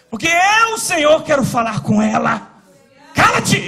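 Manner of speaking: loud, high stern command; commanding voice of God. Retold or imitated. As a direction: boom it down as God rebuking. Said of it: "Ele falou assim".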